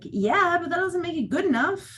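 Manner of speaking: firm but light retort; pointed retort. Retold or imitated. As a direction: push back on a hollow compliment. Retorting to hated phrase.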